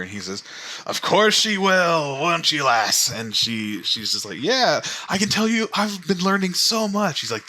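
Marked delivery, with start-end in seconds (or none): 0.9-3.1 put-on hearty old grandfather. 4.4-7.2 put-on high-pitched, eager child's voice; excited granddaughter. Excited granddaughter tells of her learning.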